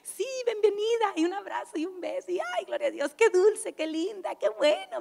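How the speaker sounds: high, gushing church-lady greeting; mock sweet sister greeting a newcomer, high and gushing; sugary welcome.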